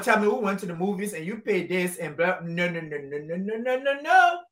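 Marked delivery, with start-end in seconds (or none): none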